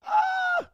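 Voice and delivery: squeaky high falsetto note; high sung note. Shown as a demonstration. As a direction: hit a thin high note. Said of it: "if there's a high note in a song".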